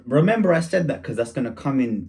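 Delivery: usual speaking voice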